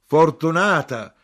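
loud, drawn-out high exclamation; slow, high, loud outcry; exclaiming. A narrator's reading. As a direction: cry out in amazement, stretching it. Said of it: "esclamò mia madre".

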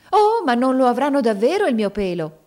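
loud, high-pitched, indignant protest; storybook character's brusque outcry. A narrator's reading. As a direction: object sharply, voice jumping up. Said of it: "essa affermò bruscamente".

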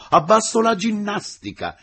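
high, loud mock-protest cry; joking shouted greeting. A narrator's reading. Engineer greets the teacher, jokingly shouting. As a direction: shout it as a playful slogan. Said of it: "saluto scherzoso".

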